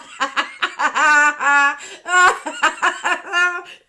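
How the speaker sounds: big high shrieking laugh; real loud laughing fit; helpless loud laugh. Real, not acted. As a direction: burst out laughing, near tears. Laughs at own picture.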